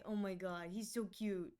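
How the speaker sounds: normal talking voice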